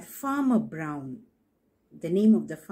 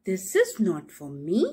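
stick refusing; 2 semitones higher, 5 dB louder, much faster than usual. Acted.

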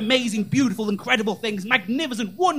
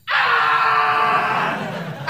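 high joyful cry of "Ah!"; joyful shout; 11 semitones higher than usual. Retold or imitated. The Ghanaian man spotting a fellow Ghanaian, happy high "Ah!". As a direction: let out a delighted yelp of "Ah!". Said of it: "And then he went".

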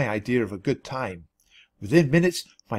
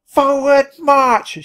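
very high, loud, drawn-out yell; yelled command; 13 semitones higher, 8 dB louder, much slower than usual. A narrator's reading. Penelope leading the trek, yelling the command. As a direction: bellow the march order slowly. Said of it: "she yelled".

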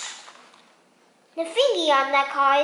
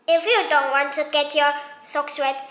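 usual speaking voice

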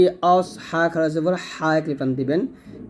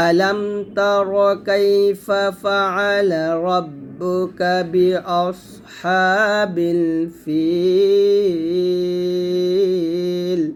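slow, melodic Quran chant; slow recitation; much slower than usual. Shown as a demonstration. Teacher recites the whole verse slowly. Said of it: "একসাথে বললে হবে".

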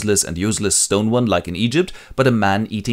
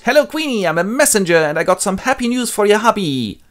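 high, very loud announcement; eager messenger; 12 semitones higher, 4 dB louder than usual. Acted. Messenger announcing himself, high and very loud. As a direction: proclaim yourself. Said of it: "he meets Jocasta and goes".

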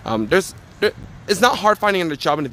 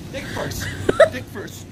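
unvoiced whisper of dick first; slower than usual. Real, not acted. Muttering to himself mid crab walk, whispered. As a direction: breathe dick first under the strain.

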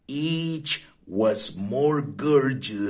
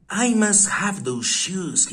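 higher, eager rich customer crying out; a touch of urgent desire in the voice; 7 semitones higher, 3 dB louder than usual. A narrator's reading. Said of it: "he cried".

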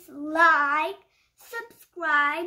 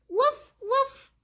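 high little woof woof of a puppy; puppy bark; 6 semitones higher, 4 dB louder than usual. Acted. The puppy Biscuit barking back, high happy woofs. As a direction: yip it like a happy small dog.

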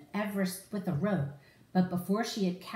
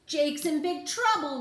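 exclaim; 8 semitones higher than usual. Acted. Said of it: "Rubble exclaimed".

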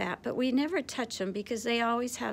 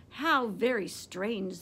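plain reading voice

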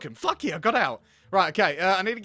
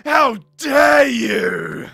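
drawn-out furious shout, stretched very slow; mock outrage; 3 semitones higher, 10 dB louder, much slower than usual. Girlfriend fakes a fight for the teacher, shouting. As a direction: roar it like a hammed-up betrayed girlfriend.

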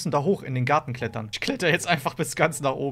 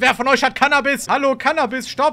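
loud, higher raiding cop yelling; hammed-up police raid, shouting at suspects; 6 semitones higher, 4 dB louder than usual. Spielt Razzia-Polizist, ruft. (übertriebener Polizist bei der Razzia, laut rufend)

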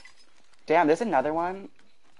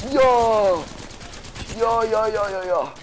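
excited yelling; 8 semitones higher, slower than usual. Real, not acted. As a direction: shout it out, hyped. Excited shouting at kill.

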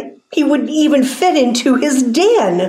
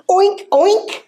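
very high-pitched oinks; high put-on pig squeal; squealing piglet; 10 semitones higher than usual. A narrator's reading. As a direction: oink like a little piglet. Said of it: "the piglet squealed".

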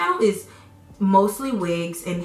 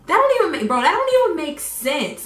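outraged; 9 semitones higher, 5 dB louder than usual. Real, not acted. Outraged at paying to see.

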